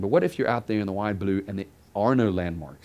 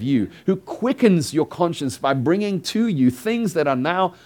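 higher, louder pulpit exhortation; impassioned preaching; 5 semitones higher, 6 dB louder than usual. Real, not acted. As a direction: preach it with force, pressing each phrase.

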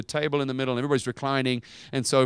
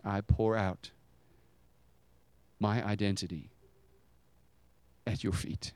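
soft offering; 4 semitones lower, 6 dB quieter than usual. Retold or imitated. Woman's offering voiced by preacher.

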